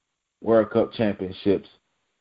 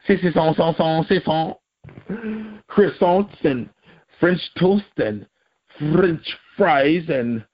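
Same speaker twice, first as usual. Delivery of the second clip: high-pitched cartoon French babble; mock French accent; 8 semitones higher than usual. Vlogger spoofing a Frenchman listing French things, mock French accent. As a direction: stammer through stock French words in a silly high voice, French accent.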